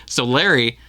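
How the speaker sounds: ordinary talk, nothing marked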